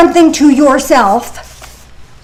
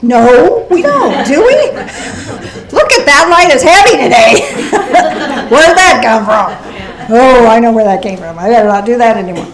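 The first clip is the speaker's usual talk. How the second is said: laughing self-talk; 4 dB louder than usual. Pretend. Voicing self-talk at mirror.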